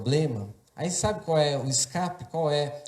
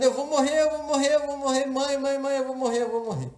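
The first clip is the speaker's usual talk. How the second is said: put-on high, loud panicked child's cry; retold scream; 9 semitones higher, 3 dB louder than usual. Child in pain crying out to his mother, high reenacted scream. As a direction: wail it over and over, calling for mother. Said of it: "eu continuei gritando".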